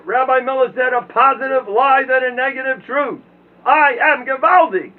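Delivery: high, loud sermon rant; full-force preaching. Acted. Preaching to the young man against self-doubt, loud. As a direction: build to a roaring boast.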